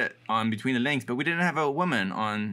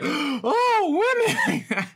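put-on much higher and louder, a scandalised little old lady; shocked old lady; 4 dB louder, much slower than usual. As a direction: gasp it out like a sweet old lady who is horrified. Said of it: "She's like".

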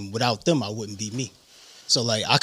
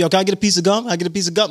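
put-on higher, louder kid begging; pestering kids; 7 semitones higher, 6 dB louder, much faster than usual. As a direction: nag for gum like a schoolkid. Kids asking for gum, acted.

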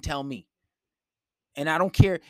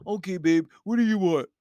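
mock eager boyfriend; 2 semitones higher, 3 dB louder than usual. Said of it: "if you pander to her and say".

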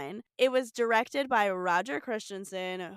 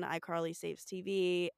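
ordinary delivery

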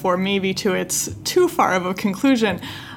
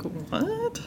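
confused listener; 13 semitones higher, 9 dB quieter than usual. Retold or imitated. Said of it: "people would be like".

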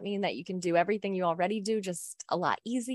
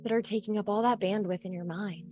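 soft spa whisper; 6 dB quieter than usual. Acted. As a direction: lull them into trance gently. Leads a guided hypnosis.

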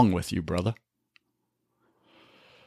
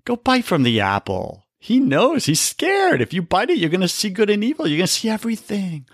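a lightly voiced sly tempter, pitched higher and fast; tempting serpent voice; 9 semitones higher than usual. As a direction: coax him toward the apple like the serpent, quick and high. Tempter voice urging the apple.

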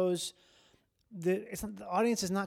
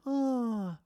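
high, drawn-out mock pity sound; imitated audience "ah"; 6 semitones higher than usual. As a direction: sigh "ah" like a sorry audience. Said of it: "it elicits an".

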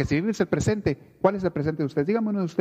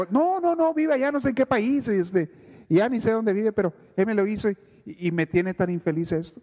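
aggrieved whine; 6 semitones higher than usual. Retold or imitated. Preacher imitates someone nursing a fifteen-year grudge, whiny and high. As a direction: play the wounded victim who won't let go. Person blaming distant offender.

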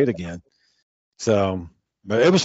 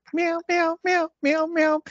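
high squeaky meows, quiet; cat meowing; 4 dB quieter, slower than usual. Retold or imitated. Kid in the game lobby meowing at everyone, squeaky cat voice. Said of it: "meowing like a fucking cat".

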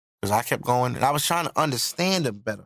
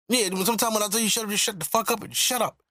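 retold irritated father; 8 semitones higher than usual. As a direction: imitate a dad telling his son off, pitched up. Retold angry father snapping back.

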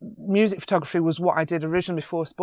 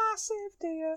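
tiny, very high, soft squeal; mock awe; 13 semitones higher, 8 dB quieter, slower than usual. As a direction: squeak it softly in mock amazement. Awed repeat in deer story.

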